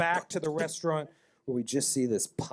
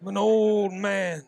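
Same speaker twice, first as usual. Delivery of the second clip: put-on old man; 6 semitones higher, 5 dB louder than usual. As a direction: protest like a frail old man. Old NPC complains.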